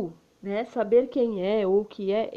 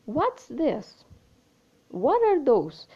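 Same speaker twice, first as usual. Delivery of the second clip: "What's" and "What" said higher and louder, falling after; stressed question word; 4 semitones higher, 3 dB louder, much slower than usual. Shown as a demonstration. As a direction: hit the question word, then let the pitch drop. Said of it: "eu falei what com mais força, mais alto".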